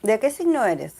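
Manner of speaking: quick question in Spanish; spanglish. Real, not acted. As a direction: slip into Spanish, casual tone. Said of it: "sorry for the Spanglish".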